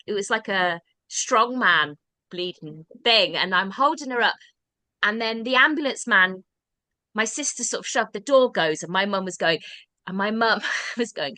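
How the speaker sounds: ordinary delivery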